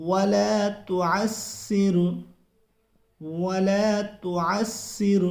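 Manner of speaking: very slow, chanted recitation. Shown as a demonstration. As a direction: recite it slowly, word by word. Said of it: "پڑھیے ناظرین". (آہستہ ترتیل سے تلاوت)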